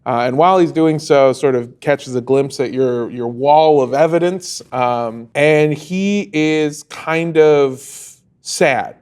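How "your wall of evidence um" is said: ordinary talk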